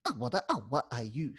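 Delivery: Awa, Awa with a choppier scratch, then Ayush; other scratch pattern. Shown as a demonstration. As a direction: cut the scratch shorter. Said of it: "That's the other scratch pattern".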